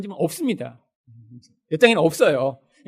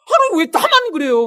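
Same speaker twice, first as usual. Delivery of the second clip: whiny complaint; 9 semitones higher, 5 dB louder than usual. Retold or imitated. Child-like complaint to God.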